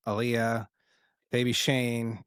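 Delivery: ordinary delivery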